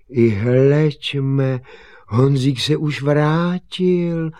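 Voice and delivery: high, loud astonishment; surprised father. Acted. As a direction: exclaim in wonder, drawing it out. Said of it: "divil se tatík".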